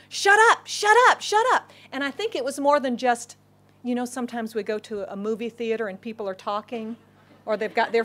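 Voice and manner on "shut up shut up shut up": high, loud, rapid-fire repeats; crowd shouting down. Acted. Crowd shouts down the beggar calling Jesus, high loud repeats. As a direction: drown him out, hammering each repeat. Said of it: "the people are saying".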